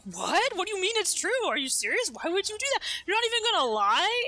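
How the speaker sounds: half-playful outrage at a shameless admission; incredulous. Retold or imitated. Disbelieving retort to a confession.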